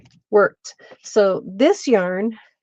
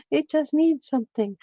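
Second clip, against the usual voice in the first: sing-song higher echo; playful higher self-echo; 6 semitones higher than usual. Real, not acted. Crafter repeats the line playfully.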